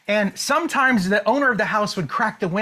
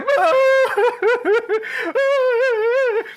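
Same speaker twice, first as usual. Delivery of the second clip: laughing; 13 semitones higher, 3 dB louder than usual. Real, not acted. Laughs at his own story.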